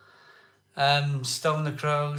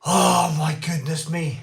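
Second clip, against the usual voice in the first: starstruck gasp; 2 semitones higher, 5 dB louder than usual. Real, not acted. Overwhelmed exclamation.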